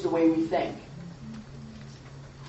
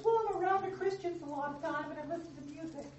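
mock naive believer; 9 semitones higher, 8 dB quieter, faster than usual. Mocking an osmosis believer.

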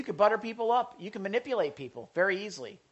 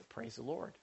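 much softer, lower, said under his breath; a private sigh of relief to himself; inner thought; 5 semitones lower, 14 dB quieter than usual. Retold or imitated. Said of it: "I was thinking to myself".

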